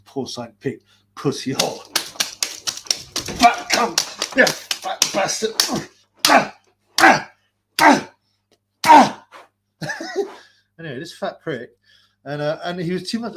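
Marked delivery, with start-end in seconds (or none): 3.4-5.5 mock insult. Mocking insult, higher voice. 6.3-9.3 rising mocking ah sounds, much higher and louder; climbing taunting yelps; four mocking yelps climbing higher and louder; mocking cries. Real, not acted. Rising cackle.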